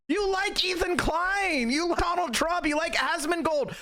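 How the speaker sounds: high-pitched, shouted disbelief; incredulous ranting. Real, not acted. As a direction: yell at them, utterly baffled. Ranting at his enemies.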